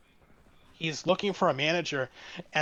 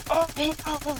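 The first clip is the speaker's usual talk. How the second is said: mock cough; 12 semitones higher, faster than usual. Customer fake coughing at man.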